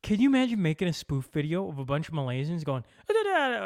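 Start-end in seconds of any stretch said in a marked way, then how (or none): 3.1-3.7 mock Malaysian accent. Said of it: "I don't know how to do an accent".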